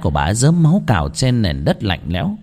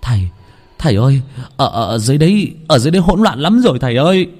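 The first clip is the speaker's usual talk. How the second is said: breathless panic; 3 semitones higher, faster than usual. Acted. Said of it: "Thạnh hồn hển".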